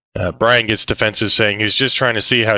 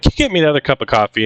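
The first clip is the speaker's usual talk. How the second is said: rattled off very fast; a quick, brushing-off ask; rushed request; 5 semitones higher, much faster than usual. Retold or imitated. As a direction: hurry past the moment to the coffee. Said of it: "He's like".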